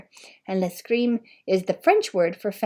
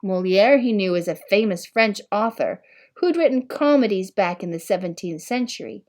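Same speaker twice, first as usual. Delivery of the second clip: plain reading voice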